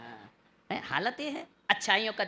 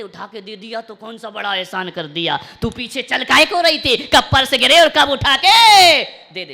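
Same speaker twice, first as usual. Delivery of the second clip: grumbling that rises to a loud rebuke; mock scolding; 11 dB louder than usual. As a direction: snap at the wife. Husband snapping at wife.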